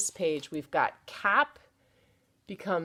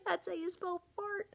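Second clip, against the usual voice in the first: giggling; 12 semitones higher, 8 dB quieter than usual. Real, not acted. Teacher giggling at rude word.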